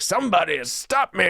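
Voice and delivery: high, manic cartoonish cry, imitated lightly; lightly voiced wild mask guy shouting out; Jim Carrey. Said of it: "and he's like".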